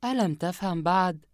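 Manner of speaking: a touch of mocking scorn; lightly sneering, high voiced; sarcastic. Yumna mocking Asem over the phone, mildly sarcastic. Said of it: "يمنى بتهكم".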